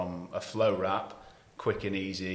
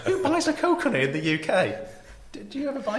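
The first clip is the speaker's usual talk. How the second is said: laughing; 5 dB louder than usual. Real, not acted. Laughing at the coconut story.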